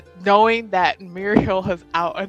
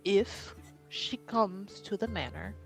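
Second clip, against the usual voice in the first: low-voiced, deliberate confidential instruction; hushed warning; 4 semitones lower, 6 dB quieter than usual. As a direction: warn them quietly, softly, with measured pauses. In character, confidential warning to companion.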